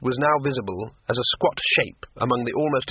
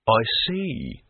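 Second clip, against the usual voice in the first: sea troll voice; 2 semitones lower than usual. A narrator's reading.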